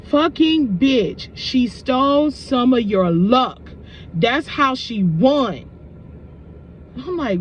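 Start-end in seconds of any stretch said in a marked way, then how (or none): 0.0-5.7 slow, heavy, drawn-out warning; spirit's voice. Retold or imitated. Spirit warning her the coworker stole luck, slow and scornful. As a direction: drag the words out with scorn. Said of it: "Spirit is telling me".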